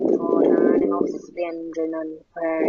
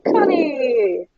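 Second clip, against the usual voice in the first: raised, drawn-out call with a hint of rasp; raspy call; 8 semitones higher, 6 dB louder than usual. A narrator's reading. Husband calling to his wife as she walks past, lightly raspy. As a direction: call out high and loud, falling away. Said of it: "began to say in a raspy voice".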